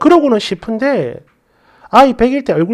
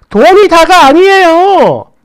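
pointed reply; 6 semitones higher, 5 dB louder, slower than usual. Retold or imitated. Daughter-in-law's pointed reply.

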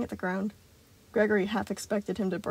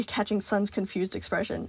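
ordinary delivery, nothing marked

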